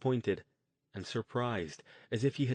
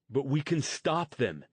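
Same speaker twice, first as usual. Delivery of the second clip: higher and louder, pushing back; insist, objecting hard; protesting; 5 semitones higher, 5 dB louder than usual. Acted. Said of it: "Jason protested".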